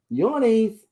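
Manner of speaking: high sing-song pet talk. Real, not acted. Owner cooing at her yawning bunny, high baby talk. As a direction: coo it to the sleepy pet.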